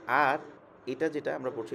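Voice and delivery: ordinary talk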